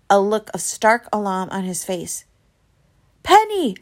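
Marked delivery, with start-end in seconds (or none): 3.2-3.8 high, loud alarmed shout; yell. A narrator's reading. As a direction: call out in sudden alarm. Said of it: "I heard him yell".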